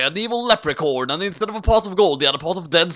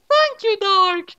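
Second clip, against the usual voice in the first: put-on much higher girlish voice, slow and dreamy; swooning; slower than usual. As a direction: swoon as you thank your rescuer. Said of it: "she said, swooning".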